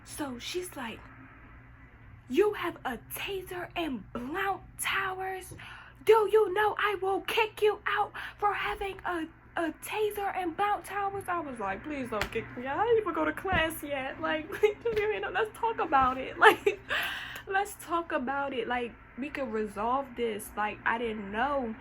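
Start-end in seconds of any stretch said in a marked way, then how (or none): 2.3-11.2 hammed-up scolding security officer; stern guard. Guard threatening eviction. 11.8-21.7 pleading. Retold or imitated. Begging not to be expelled.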